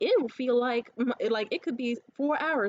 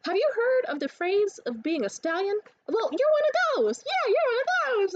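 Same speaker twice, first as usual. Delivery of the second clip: much higher, louder hyped-up line; hyped flirting; 11 semitones higher, 4 dB louder than usual. Retold or imitated. Flirting hopped up on Mountain Dew, high loud hype. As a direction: sell it bold, buzzing on Mountain Dew. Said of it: "I just was like".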